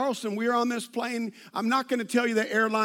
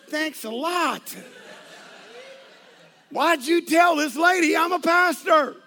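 slow dry thanks, then higher and louder; sarcastic then exasperated; 6 semitones higher, 4 dB louder than usual. Retold or imitated. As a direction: needle the wife, then burst out at her. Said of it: "I look at her and I go".

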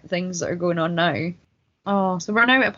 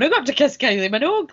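higher, louder mock boast; imitating a thrilled interviewee; 6 semitones higher, 4 dB louder than usual. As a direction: gush as if it's your best day ever. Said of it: "like it was the best day of his life".

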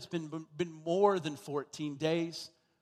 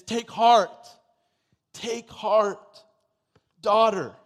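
loud, ringing call of comfort; Jesus calling out to the woman, loud and ringing; 4 semitones higher, 11 dB louder, slower than usual. Acted. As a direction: proclaim it across the crowd, higher. Jesus calls out to healed woman.

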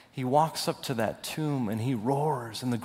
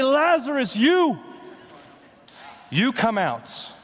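commanding call; 10 semitones higher than usual. Retold or imitated. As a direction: call him out by name, pointed. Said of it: "He had to say".

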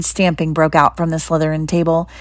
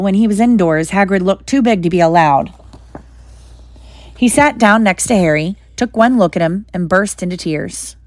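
ordinary delivery, nothing marked

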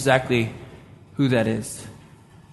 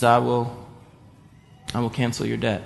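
quiet mercy; 5 semitones lower, 9 dB quieter than usual. Retold or imitated. Collector forgiving the debt.

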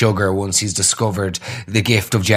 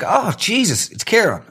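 surprised; 9 semitones higher than usual. Retold or imitated. Surprised recognition on the street.

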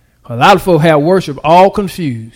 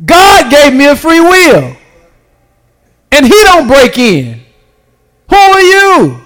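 full-force preaching; 7 semitones higher than usual. Acted. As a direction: preach it hard, lift the whole church.

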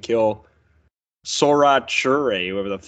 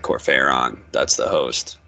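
low villain voice; 10 semitones lower than usual. Retold or imitated. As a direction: drop the voice low and ominous. Marduk voice, low.

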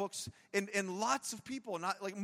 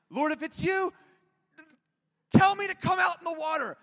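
much higher and louder eager call; bold blurt; 8 semitones higher, 10 dB louder than usual. Retold or imitated. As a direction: shout it out before thinking. Peter blurts bold request.

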